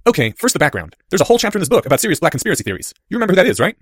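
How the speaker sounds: put-on eager boy launching an explanation; Harry. A narrator's reading. Boy giving his friend the background, high and brisk.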